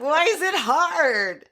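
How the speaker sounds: high-pitched retold question; mock bewilderment. Man groping for a grenade, in mock bewilderment. Acted panicked exchange in retold scene.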